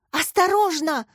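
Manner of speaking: high cry of warning. A narrator's reading. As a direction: shout a sudden warning. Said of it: "вскричала Яся". (предостерегающий вскрик)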